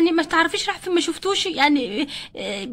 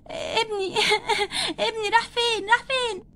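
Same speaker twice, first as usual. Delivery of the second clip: high, softened sobbing wail; tearful panic; 4 semitones higher, 4 dB quieter than usual. Acted. Mother crying for her missing son, high tearful panic. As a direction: wail for a lost child between sobs.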